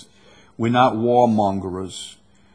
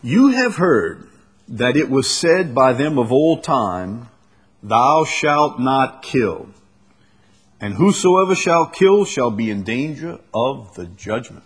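solemn scripture reading; 4 dB louder than usual. Acted. Reads scripture aloud, slow.

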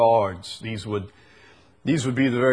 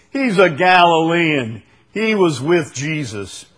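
raised, louder accusation; accusing; 5 semitones higher, 6 dB louder than usual. Acted. A fellow identifies Peter to the others, accusing. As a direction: call him out in a raised voice.

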